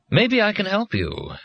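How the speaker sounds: a teaching machine playing a gentle father; kindly offer. A narrator's reading. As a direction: offer help slowly and sweetly. Kindly Dad offers help.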